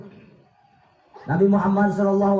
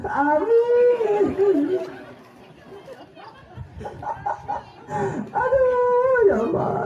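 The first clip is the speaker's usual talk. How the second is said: high hammed-up crying wail; mock wailing; 11 semitones higher, slower than usual. Wife hears the husband's prayer, hammed-up high wailing.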